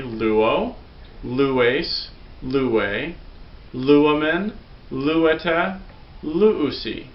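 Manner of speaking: slow, clear teacher recitation of luo forms; Greek paradigm recited. Shown as a demonstration. Teacher reciting forms for students to repeat. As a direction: say each form for the class to repeat. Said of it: "you can repeat after me".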